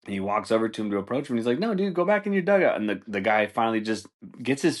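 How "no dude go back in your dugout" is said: much higher, exasperated command; high mock umpire order. Umpire ordering the coach back to the dugout, high and exasperated. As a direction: bark him back to the dugout.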